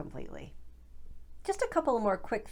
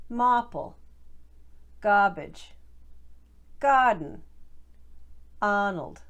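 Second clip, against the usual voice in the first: louder Boston drill words, R dropped, ah held long; each word clipped to a Boston ah; Boston accent; 2 semitones higher, 4 dB louder, much slower than usual. Shown as a demonstration. Coach drills Boston words one by one, loud Boston ah. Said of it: "Let's try a few more".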